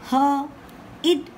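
usual speaking voice

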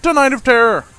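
high, loud showman intro; hammed-up announcer. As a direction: welcome them like a carnival host. Channel intro to horror game.

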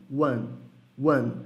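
"one" ending in a closed-lip M, "uam"; one mal pronunciado. Shown as a demonstration. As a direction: say "one" the Brazilian way, without the final N. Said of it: "a gente tem a fama de falar". ("one" à brasileira, terminando em "uam")

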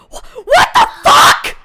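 high strained whisper-shout; shocked whisper-shout. Real, not acted. Huge donation during whisper hour.

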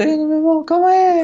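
high, loud frantic wait; frantic plea. Pretend. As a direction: beg him to wait, flustered. Friend pleading to wait. (súplica agitada, alta e aguda)

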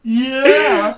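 high, loud whoop of triumph; gleeful shout. Real, not acted. Player gloats over a chest find, gleeful shout. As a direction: crow it at your beaten rival.

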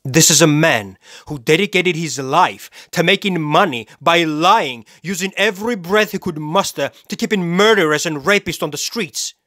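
high, driving denunciation; indignant rant. Acted. Killer denounces the lawyer he killed, outraged. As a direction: condemn them with mounting outrage.

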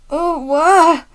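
loud, high pained groan. A narrator's reading. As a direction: groan as if being squashed. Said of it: "groaned the van".

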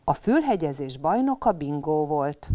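ordinary delivery, nothing marked

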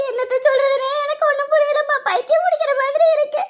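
high, tearful outpouring; acted helpless weeping; distraught crying. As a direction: lament through sobs, rising into a wail. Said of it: "அழாதீங்க".

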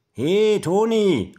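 loud, high, drawn-out call; slow singsong holler. A narrator's reading. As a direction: call down to someone from the climbing frame. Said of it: "ruft Ben vom Klettergerüst herunter".

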